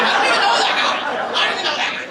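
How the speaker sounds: high, half-laughed bewilderment; mock bewildered self. Comic retelling his birthday surprise, high and half-laughing. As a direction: squeak it out in disbelief. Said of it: "So I'm like".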